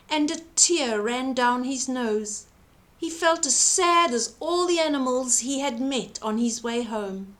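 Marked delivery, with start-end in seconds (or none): none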